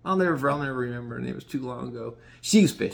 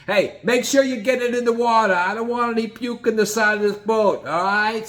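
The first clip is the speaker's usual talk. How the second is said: gruff Gino; 8 semitones higher than usual. Retold or imitated. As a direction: boss everyone around. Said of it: "Gino goes".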